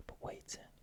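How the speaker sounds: ordinary delivery, nothing marked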